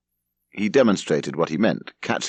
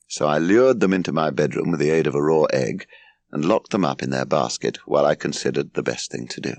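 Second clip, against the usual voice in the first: plain reading voice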